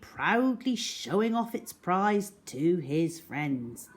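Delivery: usual speaking voice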